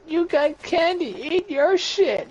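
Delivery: high mock retort, dragged out at the end; mocking friend voice. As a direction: squeak out the comeback like a whiny buddy.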